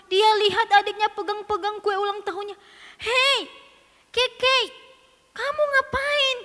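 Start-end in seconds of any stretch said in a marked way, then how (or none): 3.0-6.4 high, loud, accusing call; indignant older sister. Acted. Sister catching Keke at her cake, sharply indignant. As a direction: catch them red-handed.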